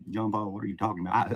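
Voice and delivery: ordinary delivery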